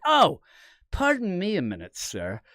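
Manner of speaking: high, put-on pompous excuse, falling at the end; mock self-important. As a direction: imitate a friend showing off. Mimics friend relishing the attention.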